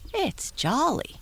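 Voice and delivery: high, slow jolly pony; talking pony. Acted. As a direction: sing it out like a happy pony. [name] voice.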